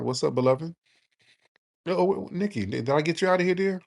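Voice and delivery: ordinary talk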